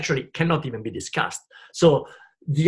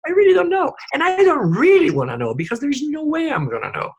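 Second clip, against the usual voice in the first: loud exclamation; 12 semitones higher, 7 dB louder than usual. Real, not acted. Emphatic outburst about not knowing.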